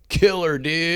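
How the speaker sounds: high, stretched-out surfer exclamations; surfer dude. Acted. Comic showing how surfer culture rubs off, surfer-dude voice. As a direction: whoa like an awestruck surfer bro. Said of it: "I've heard surfer in real life".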